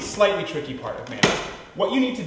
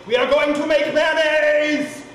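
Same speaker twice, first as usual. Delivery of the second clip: much higher, louder and slower, drawn out with glee; triumphant announcement; 10 semitones higher, 5 dB louder, slower than usual. Real, not acted. Host cheering the mayonnaise into being, triumphant. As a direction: proclaim it like a victory. Triumphant build as oil streams.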